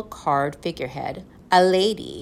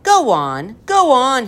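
loud urging, climbing higher; calling out; 7 semitones higher, 7 dB louder, slower than usual. A narrator's reading. Professor needs more jokes to steer, calling out. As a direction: call to the crew for more. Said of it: "he called".

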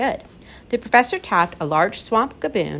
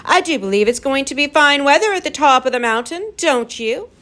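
higher, louder, brightly overcheerful; raised voice; 8 semitones higher, 5 dB louder than usual. A narrator's reading. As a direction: talk loudly over the jeering crowd. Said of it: "The professor raised his voice again".

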